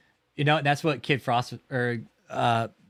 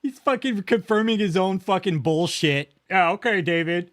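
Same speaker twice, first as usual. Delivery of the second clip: higher, louder slow scoff; scoffing sarcasm; 6 semitones higher, 5 dB louder than usual. Real, not acted. Streamer scoffing at David to chat, sarcastic. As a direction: drag it out, mocking him.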